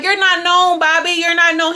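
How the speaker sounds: high, loud taunting imitation; mocking Ray. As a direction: taunt him, pitched high. Voicing Ray's taunt at Bobby.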